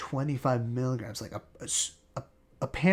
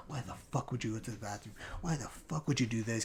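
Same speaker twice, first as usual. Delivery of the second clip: muttered; 8 dB quieter, faster than usual. Retold or imitated. Muttering to himself on toilet.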